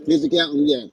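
ordinary delivery

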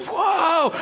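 high, long mock-alarmed whoa; panicked whoa. Preacher imagines being the slow driver, high panicked whoa. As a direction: cry out as if everything is racing. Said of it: "everything was just super fast".

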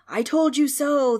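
loud, drawn-out high cry; ghost's shout. A narrator's reading. Ghost rubs it in as demons arrive, shouting. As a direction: shout it slowly as vindication. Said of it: "the ghost shouted".

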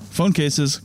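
ordinary talk

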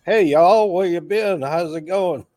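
loud, high Southern drawl greeting; big, loud Southern "hey y'all"; Southern accent. Shown as a demonstration. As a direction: holler it like a hammed-up Southerner. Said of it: "kind of accent".

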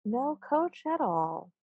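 soft, slow, mock-mournful gnome; sad gnome. Chief gnome answering his men, mock-sad and soft. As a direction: lament it in a small high voice. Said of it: "shakes his head very sadly".

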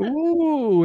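a high, teasing ooh. Real, not acted. Host teasing the nervous guest, high ooh. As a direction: coo at her playfully.

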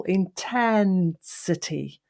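"intensity" slow, with "ten" held long; long central vowel. Shown as a demonstration. Coach demonstrating the central syllable, "intensity" with "ten" drawn out. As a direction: stretch the middle vowel of "intensity". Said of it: "and drew that out. I made the vowel longer".

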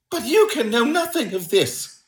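high, agitated little-man voice; nervous protest. A narrator's reading. Little man doubting Holmes knows of it, nervous protest. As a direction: object anxiously in a high pitch.

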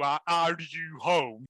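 slow, drawn-out creepy question; mock creepy drawl. Mocking the killer's text, slow and creepy. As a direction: stretch it out like a lurking creep.

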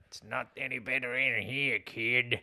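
lightly voiced movie cabbie; imitating cab driver. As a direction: do a mild impression of a cab driver.

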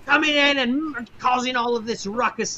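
high, full-voiced furious crowd yell; angry yelling. Game master voicing dock crowd at fleeing ship, angry yelling. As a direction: yell after them in outrage, easing off at the end. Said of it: "you're just hearing the people yelling".

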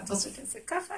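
light, obliging asking; acted willing question. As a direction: offer softly, pitch rising on ככה. Queen asking what king wants.

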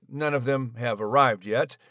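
usual speaking voice

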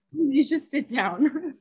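chuckling through a slow telling-off; laughing. Retold or imitated. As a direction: laugh while ordering him to sit. Laughing while telling captain off.

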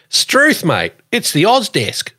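a Kiwi hamming up Ocker Aussie; mock Australian. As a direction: play up an exaggerated Australian accent. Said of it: "you sound, uh, very Australian when you say stuff like that".

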